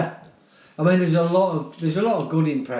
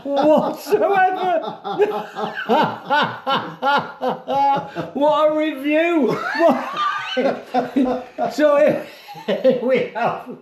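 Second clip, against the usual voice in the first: high laughing words, half shouted; laughing hard; 10 semitones higher than usual. Real, not acted. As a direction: talk through giggles. Real laughter over owl clip.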